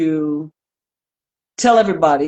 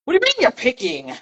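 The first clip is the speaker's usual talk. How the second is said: mock indignant; 6 semitones higher than usual. Acts out their reaction to being picked.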